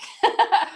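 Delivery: high burst of laughter; real amused laugh. Real, not acted. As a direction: laugh out loud, high and quick. Amused that sisters don't look alike.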